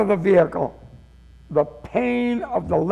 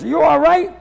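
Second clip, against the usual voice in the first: mildly louder, higher call of concern; quick raised-voice check on someone; 6 semitones higher, 5 dB louder than usual. Retold or imitated. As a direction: call over to a stranger, imitating an old gentleman. Old gentleman calling to limping walker.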